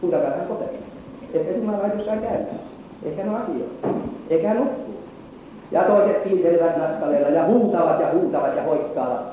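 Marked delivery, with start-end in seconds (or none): 0.0-4.9 softly mocking, pitch raised on each taunt; Elian pilkka. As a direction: imitate a prophet needling with mock-innocent guesses. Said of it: "Ja Elia pilkkaa niitä". (pilkallisesti, hiljempaa ja korkeammalta)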